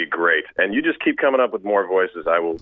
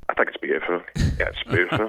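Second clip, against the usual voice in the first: put-on soft Irish accent, softer than his own voice; dreamy Irish lilt on "beautiful"; quiet, wistful Irish accent; Colin Farrell's Irish accent; 4 dB quieter, faster than usual. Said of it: "But the Irish would be".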